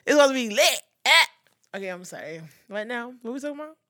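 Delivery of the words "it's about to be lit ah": louder, rising hype ending in a yell; hyped. Real, not acted. Host hyping her birthday month, loud and rising. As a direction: get the crowd going for her birthday.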